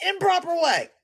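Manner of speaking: amused repeat of "Improper way"; mocking laugh. Real, not acted.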